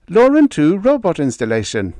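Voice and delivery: high, loud and demanding, a pompous officer; brusque officer Roan. Acted. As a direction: bark questions like an affronted senior officer. Roan answers.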